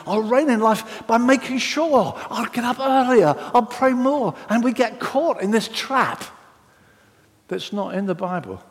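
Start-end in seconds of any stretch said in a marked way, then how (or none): 0.1-4.3 earnest striver. Retold or imitated. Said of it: "And they think".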